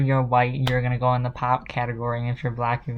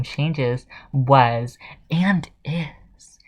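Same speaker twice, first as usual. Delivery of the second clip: whisper; much slower than usual. Real, not acted.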